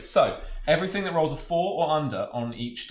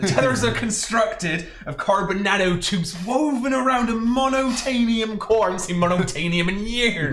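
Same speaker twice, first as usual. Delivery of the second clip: put-on West Country accent; 9 semitones higher, 5 dB louder than usual. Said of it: "I still have to do some West Country accent".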